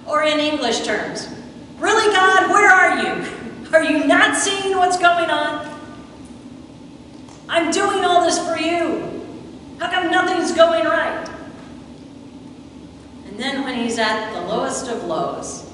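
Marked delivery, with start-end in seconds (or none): 1.8-11.3 pleading with God. Retold or imitated. Nathanael's plea to God paraphrased.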